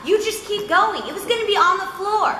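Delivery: high-pitched, amused imitated rant; mock exasperation. Director ranting about the sinking mic stand, mock-exasperated.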